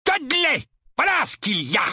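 high-pitched irate uncle; angry roar. A narrator's reading. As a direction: roar the name out in anger. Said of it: "rugit l'oncle Vernon". (rugissement de colère, voix aiguë)

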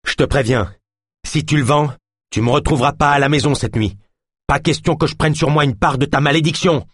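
high, firm warning; forceful conviction. A narrator's reading. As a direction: lay down an ultimatum with full conviction. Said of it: "plaça toute la force de sa conviction dans sa voix". (avertissement ferme, voix montée)

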